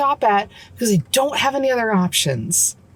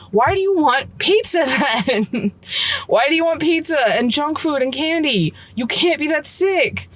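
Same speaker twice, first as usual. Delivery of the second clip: higher, incredulous questioning; exasperated disbelief; 6 semitones higher than usual. Real, not acted. Mom venting at sick kids wanting pizza, incredulous. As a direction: she can't believe they want pizza now.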